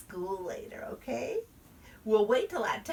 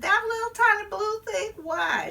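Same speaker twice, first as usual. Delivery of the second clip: whiny protest; 13 semitones higher, 5 dB louder than usual. Acted. Child protests the mask to mama.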